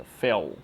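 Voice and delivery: "Fel" low, with a dark L off the teeth. Shown as a demonstration. Teacher models it for the student to repeat, "Fel" with untouched dark L. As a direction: say "Fel" with the tongue leaning but never touching. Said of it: "mas não encosta".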